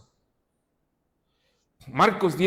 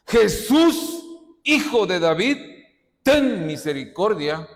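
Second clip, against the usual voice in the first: loud, raised-pitch plea; crying out; 4 semitones higher, 5 dB louder than usual. Acted. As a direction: beggar calling over a crowd for mercy. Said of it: "Comenzó a dar voces".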